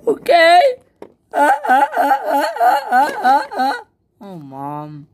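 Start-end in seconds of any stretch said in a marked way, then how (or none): 0.1-0.6 indignant shout. Acted. Mock outrage at daughters. 1.3-3.7 fake sobbing. Fake crying after teasing.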